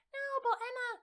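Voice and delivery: high, whiny put-on student voice; mock high-pitched complaint; objecting student. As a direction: protest like a whining pupil.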